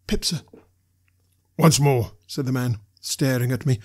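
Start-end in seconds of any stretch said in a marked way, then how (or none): 0.1-0.4 high, meek little answer; small, high-pitched boy's voice; frightened boy. A narrator's reading. 1.6-2.1 loud, menacing order; forceful, threatening command; convict's demand. A narrator's reading.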